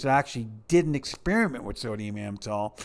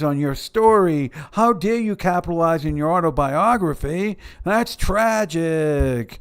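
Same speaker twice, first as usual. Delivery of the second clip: sarcastic mock outrage; 5 semitones higher, 4 dB louder than usual. Mocking someone's outrage.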